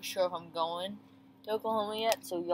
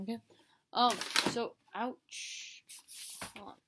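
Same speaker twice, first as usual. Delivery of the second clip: ordinary delivery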